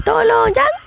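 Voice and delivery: high-pitched mock cry for help; strained, high retold wail; retold crying scream. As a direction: imitate a panicked plea without full volume. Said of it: "Aku punyalah jerit sekuat-kuat ni, aku menangis".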